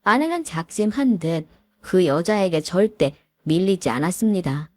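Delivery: plain reading voice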